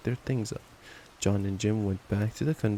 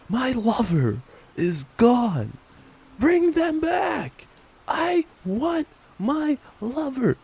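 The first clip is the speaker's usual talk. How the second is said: much higher, loud, slow mock shout; hammed-up hysterical wail; 14 semitones higher, 6 dB louder, much slower than usual. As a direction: wail melodramatically at the president. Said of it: "I'd be like".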